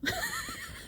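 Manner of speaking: a short squeal of laughter, high and soft; high breathy laugh. Real, not acted. Laughing at the pastor's quip, a high soft giggle. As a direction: laugh high and airy at his joke.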